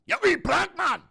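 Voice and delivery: high, screamed Scottish insult; Scottish accent. Shown as a demonstration. Showing a viewer Scottish insults, screamed Scottish accent. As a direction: shriek it loudly in Scottish. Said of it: "a Scottish accent's a bit more like that".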